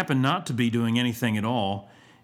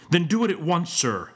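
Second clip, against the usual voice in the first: raised, threatening schoolmaster order; stern, menacing admonition; savage command; 6 semitones higher than usual. A narrator's reading. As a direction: order it sharply, dropping on the last word. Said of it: "uttered with a savage aspect".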